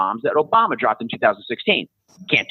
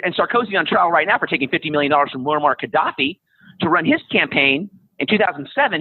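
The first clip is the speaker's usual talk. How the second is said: ordinary talk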